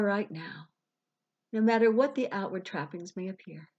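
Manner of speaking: unhurried meditation voice with long pauses; guided visualization. Acted. Life coach guiding listeners to release money worries, guided meditation.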